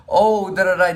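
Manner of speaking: chirpy nonsense syllables, mimicking her chatter; imitated cheerful chatter. Young worker chatting happily to a customer, mock cheerful. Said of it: "starts getting really cheerful".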